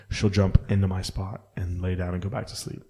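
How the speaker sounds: normal talking voice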